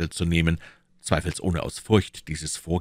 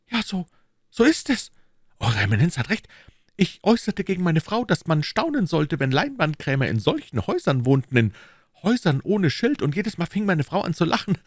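higher, louder nervous protest; anxious Bonacieux; 12 semitones higher, 3 dB louder than usual. Acted. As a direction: plead his innocence. (ängstlicher Bonacieux)

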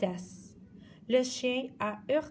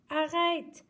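high stop cry; shouting; 7 semitones higher, 3 dB louder than usual. Acted. Woman yelling at the passing dog, high cry. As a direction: snap out a stop. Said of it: "a crié la femme".

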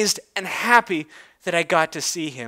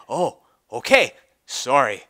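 lower, sheepish stallion voice; sheepish Big Mac; 6 semitones lower than usual. Acted. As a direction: back down lower, a big pony apologising.

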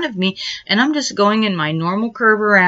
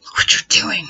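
fast whispered whiny complaint; mock the brother's exasperated gripe; brother complaining. Brother griping about letting her drive, mock whiny.

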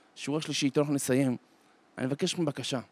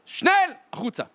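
high, loud order to get out; barked command; 10 semitones higher, 5 dB louder than usual. Acted. Guards open the train doors, barked command. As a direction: bark it at the prisoners.

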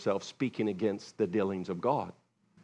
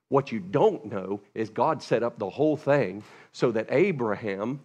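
ordinary talk

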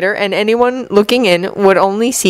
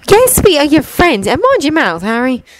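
put-on higher light girl's voice, scolding; 8 semitones higher than usual. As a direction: chide him in a bright teen girl voice. Ginny scolds Harry.

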